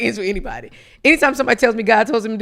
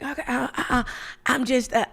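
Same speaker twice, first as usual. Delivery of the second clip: softer, halting, lost for words; retold hesitant client; 3 semitones higher, 5 dB quieter than usual. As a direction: imitate a sheepish client stumbling over fillers. Client returns, voiced as hesitant.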